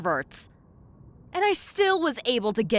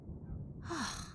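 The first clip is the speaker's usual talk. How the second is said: soft breathy sigh of defeat; long-suffering demon sigh; weary sigh; 7 semitones higher, 14 dB quieter than usual. Acted. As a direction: let out a tired sigh. Exasperated at the hopeless client.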